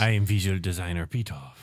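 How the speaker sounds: low-pitched put-on director voice; Pitoff. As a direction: announce yourself in a deep mock-grand voice. Impersonating the film's director.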